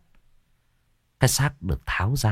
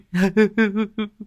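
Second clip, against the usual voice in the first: sobbing wail; 13 semitones higher than usual. Acted. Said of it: "mếu máo".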